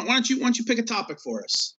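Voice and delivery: usual speaking voice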